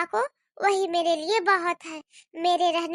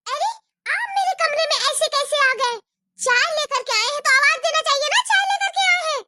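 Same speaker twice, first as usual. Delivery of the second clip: higher, louder indignant scolding; angry sister-in-law; 7 semitones higher, 3 dB louder than usual. Acted. Bhabhi scolds bua for intruding. (ऊँची आवाज़ में गुस्से से डाँटती भाभी)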